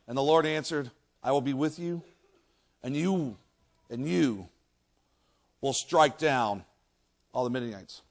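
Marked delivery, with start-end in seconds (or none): 1.2-8.0 low, soft, measured promise; solemn assurance. Acted. The Lord answering Gideon, hushed solemn assurance. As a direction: promise it quietly, with weighty pauses.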